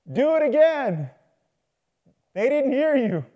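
loud, high call across distance. Acted. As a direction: shout it over to someone far off. Farmer calls across the farm.

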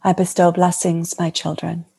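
lightly voiced serene goddess speaking; a hint of a blessing divine mother; channeling Mary Magdalene. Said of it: "And the Magdalene would like to speak".

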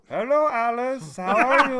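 high, slow exaggerated Indian accent; Indian uncle impression. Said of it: "an uncle that's from India".

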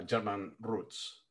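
ordinary talk, nothing marked

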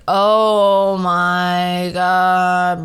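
very slowly drawn-out "oh my god"; disbelief. Retold or imitated. Reacting to a TikToker about to defend cheating, very slowly drawn out. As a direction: stretch it in exasperated disbelief.

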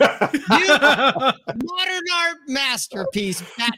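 drill sergeant. Retold or imitated. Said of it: "Let's get it right".

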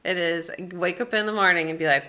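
usual speaking voice

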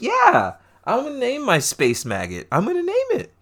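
high-pitched, insistent acted voice; playfully over-the-top resolve; defiant mock declaration. Voices character's defiant decision.

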